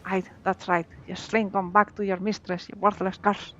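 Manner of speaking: lightly voiced pirate accent, gruff; mild pirate accent taunting. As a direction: read it like a sea pirate, a hint only. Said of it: "Love that pirate accent".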